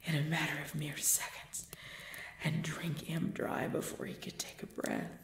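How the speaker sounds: whispered, low and soft; a vampire's whispered temptation; hungry whisper. Acted. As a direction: breathe it out like a guilty craving. Confessing her blood hunger.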